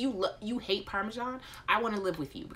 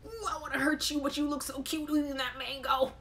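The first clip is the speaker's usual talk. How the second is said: torn parent; 7 semitones higher than usual. Retold or imitated. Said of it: "you're like".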